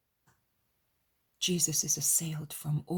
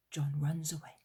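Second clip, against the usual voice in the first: usual speaking voice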